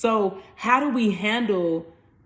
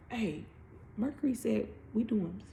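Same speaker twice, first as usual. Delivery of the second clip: much softer, confiding aside; hushed secret; 11 dB quieter than usual. Acted. Venus whispering the plan to Uranus, hushed. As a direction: pass the secret on quietly.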